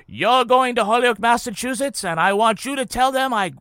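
put-on high, loud Kennedy accent oratory. Ted Kennedy quoting his mother to Holyoke, Kennedy accent. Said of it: "get into the accent now".

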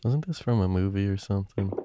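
hushed whisper. Real, not acted. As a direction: ask it in a whisper. Group whispers about the sax.

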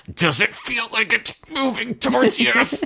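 high, drawn-out put-on voice; mock séance voice. Mock spooky Ouija board question.

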